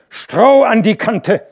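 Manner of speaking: loud, high, slow domineering order; imperious command. A narrator's reading. Hauke imperiously ordering the carter. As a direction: command it, no argument. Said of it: "rief er herrisch".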